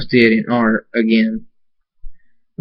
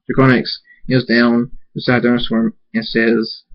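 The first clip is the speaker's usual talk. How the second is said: ordinary delivery, nothing marked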